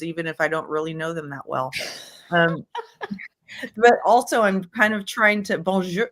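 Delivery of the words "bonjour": mock French. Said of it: "I'm terrible at accents too".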